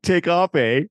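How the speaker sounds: loud, high mock-Canadian comeback. Mock Canadian retort after accent teasing.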